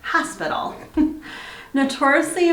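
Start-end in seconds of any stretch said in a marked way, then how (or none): none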